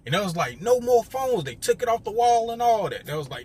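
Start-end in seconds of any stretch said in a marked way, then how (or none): 0.6-1.4 louder barked order; guard's order. Retold or imitated. Guard shutting down the phones, loud order. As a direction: call it out like a guard.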